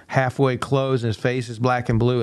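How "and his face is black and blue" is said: usual speaking voice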